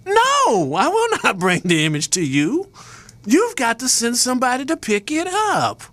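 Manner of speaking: high, firm no; defiant refusal. Retold or imitated. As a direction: turn the tables, firmly. Retold refusal to the press agency.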